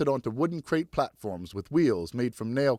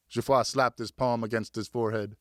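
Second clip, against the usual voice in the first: usual speaking voice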